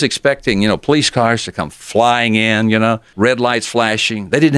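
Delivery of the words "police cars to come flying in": ordinary talk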